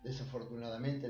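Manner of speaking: ordinary talk, nothing marked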